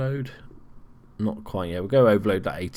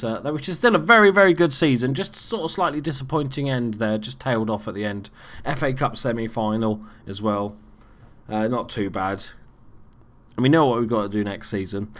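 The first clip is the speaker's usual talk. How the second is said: usual speaking voice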